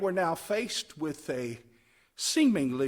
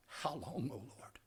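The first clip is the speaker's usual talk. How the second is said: soft pleading whisper; whispered lament; 5 semitones lower, 15 dB quieter than usual. Real, not acted. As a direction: breathe it out as a plea.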